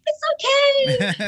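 very high, drawn-out smiling singsong; mock cheerful reassurance. Injured fighter reassuring her apologetic opponent, exaggeratedly cheerful. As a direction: stretch it out in a squeaky, smiling voice. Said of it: "smiling and".

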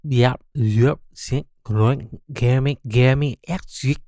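nonsense syllables run in reverse; backwards speech. Acted. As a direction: gibberish played backwards. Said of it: "I'm talking backwards".

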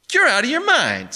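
loud, high outraged objection; indignant high cry; incredulous protest. A narrator's reading. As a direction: insist loudly in disbelief. Barney protests the price again.

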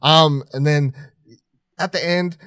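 usual speaking voice

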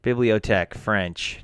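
normal talking voice

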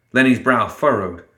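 plain reading voice

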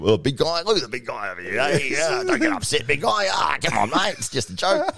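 put-on calm down the big bloke like a child; mock soothing big guy. Host teasing an upset fighter, high patronising mock soothing. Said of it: "you try to bring him".

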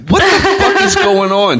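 high, incredulous squeal; retold outraged disbelief. Retold outraged reaction to news.

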